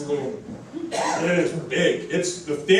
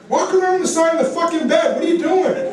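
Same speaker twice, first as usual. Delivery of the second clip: high, laughing mock exasperation; half-laughing, fed-up shout at a drunk roommate; exasperated yell; 10 semitones higher than usual. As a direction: roommate yelling at his drunk buddy to walk around the bed. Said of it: "I'm like".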